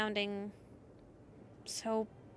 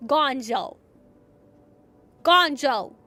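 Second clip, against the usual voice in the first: loud shout; 3 semitones higher, 13 dB louder, slower than usual. Acted. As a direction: yell the name loud. Shouting a name at threat.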